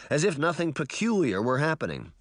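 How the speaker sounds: ordinary delivery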